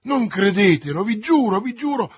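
loud, high, urgent pleading; desperate protest. A narrator's reading. D'Artagnan begs Brisemont to believe him, desperate protest. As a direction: swear your innocence desperately. Desperately swears innocence to dying man.

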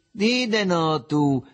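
high, slow, drawn-out mockery; gleeful teasing. A narrator's reading. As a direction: tease him merrily, stretching the words. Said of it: "fece il Riccetto sfottendolo tutto giocondo".

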